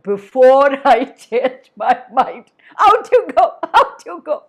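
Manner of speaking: lion letting the mouse go; booming dismissal. Acted. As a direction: shoo him out with a big laugh.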